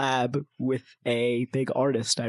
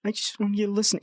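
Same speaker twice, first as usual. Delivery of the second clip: pleading younger self; 7 semitones higher than usual. Retold or imitated. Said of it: "And I said".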